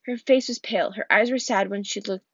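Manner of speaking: ordinary delivery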